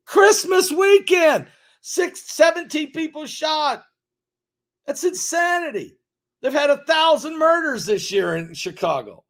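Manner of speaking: high-pitched, loud, indignant tirade building through the list; outraged shouting rant. Real, not acted. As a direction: shout it out, furious at the numbers. Outraged rant about Chicago shootings.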